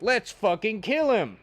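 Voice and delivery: hamming up a bloodthirsty crowd; mock angry mob. Retelling the mob wanting to kill him, mock bloodthirsty.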